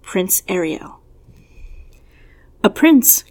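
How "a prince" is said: a sharp, rising gasp of surprise; high and louder, astonished question. A narrator's reading. Party girls hearing of a royal guest, gasping in surprise. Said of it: "gasped the girls in surprise".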